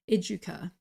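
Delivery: educa said like the start of education, ED-joo-kah; mistaken English reading. Shown as a demonstration. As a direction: read educa the English way, with a j sound. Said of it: "I thought it was edu".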